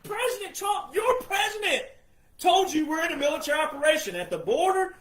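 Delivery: rising shout hammering the point home; emphatic shout. Real, not acted. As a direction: pitch jumps high, words thrown out loud. Insisting Trump told you directly.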